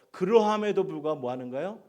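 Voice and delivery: high, loud preaching call; preaching shout. Real, not acted. Preacher to congregation, loud rousing call. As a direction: call it out to the whole church.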